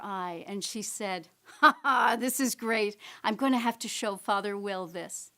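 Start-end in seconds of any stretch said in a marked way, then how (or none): 1.6-5.3 a louder, gleeful mock chuckle from mom; hammed-up delight over a surprise gift; delighted laugh. Mother delighted by the fake boarding pass, louder, gleeful. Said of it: "a great big grin and a twinkle".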